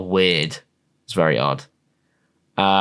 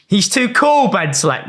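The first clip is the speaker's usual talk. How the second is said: sarcastic praise; 9 semitones higher than usual. Real, not acted. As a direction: lay the sarcasm on thick.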